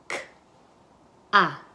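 "K, a" as two isolated sounds, long gap. Shown as a demonstration. Teacher segments ca into phonemes, sound by sound. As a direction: sound out "K, a" one at a time. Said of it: "Las fonemas".

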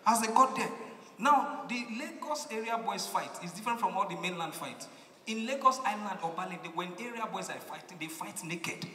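ordinary talk

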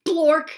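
blurted loud silly sound; loud nonsense word. Pretend. Reader forced to say the book's silly words, loudly. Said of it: "even if the words say".

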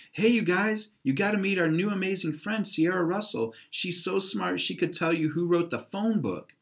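high, called-out cheerleader shout; excited shout. A narrator's reading. Haley calls out to the crowd to introduce Sierra, high and excited. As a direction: hype up the crowd for her friend. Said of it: "heard Haley shout".

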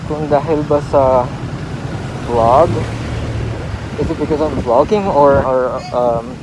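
muffled behind mask and shield; speaking with covered mouth; through a face mask. Real, not acted. Seen 3: Surgical mask and clear face shield worn throughout; references unmasked.

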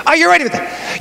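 normal talking voice

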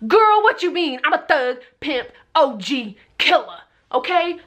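high, sassy boasting, slowing at the end; tough-guy bravado. Retold or imitated. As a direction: brag like an unbothered thug. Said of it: "And I was like".

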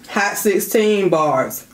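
stretched out slowly; slow hype. Real, not acted. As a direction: drag out each word. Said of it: "drawn-out hype phrase".

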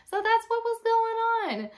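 high, loud and amused; real amusement. Real, not acted. Teacher looking back on the stressful lesson, amused. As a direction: say it on the edge of laughter. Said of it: "So laughing now".

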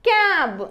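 "క్యాబ్" loud, long vowel, falling; pronunciation demo. Shown as a demonstration. Teacher modelling the blended word, "క్యాబ్" loud and drawn out. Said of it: "ఎలా పలుకుతుందో చూద్దాము".

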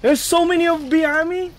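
loud high panicked yell; shouting. Real, not acted. Chased in game, shouting.

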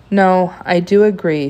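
ordinary delivery, nothing marked

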